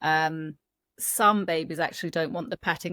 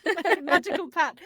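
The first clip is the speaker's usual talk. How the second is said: laughing; 12 semitones higher than usual. Real, not acted. Laughing at the magic pat idea.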